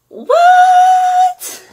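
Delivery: very high, loud excited shriek; screamed what. Real, not acted. Vlogger showing viewers the full collection, shrieking.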